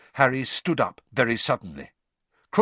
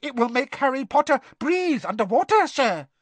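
squeaky house elf; 13 semitones higher than usual. Acted. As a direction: squeak it out urgently. Dobby voiced.

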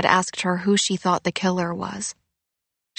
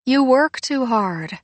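slow, higher-pitched motherly sigh; 6 semitones higher than usual. A narrator's reading. Mom to her overworking daughter, sighing. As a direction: sigh it out slowly, high then falling. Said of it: "her mom sighed".

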